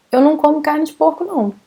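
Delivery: fast sentence, then a high tagged-on "não"; emphatic "Eu não como carne de porco, não"; double não intonation. Shown as a demonstration. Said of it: "we even give an intonation to that".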